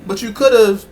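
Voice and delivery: high-pitched pleading reasoning; retold protest. Father retells protesting to daughter.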